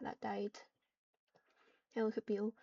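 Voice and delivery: ordinary talk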